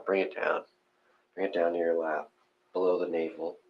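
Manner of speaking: ordinary talk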